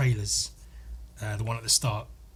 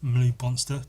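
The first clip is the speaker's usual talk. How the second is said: ordinary delivery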